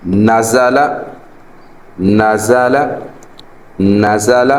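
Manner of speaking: نزل said crisply, each fatha short; short even vowels. Shown as a demonstration. Teacher models نزل for students, short and even. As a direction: model the word نزل three times, short. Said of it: "اتنی آواز نکالنی ہے".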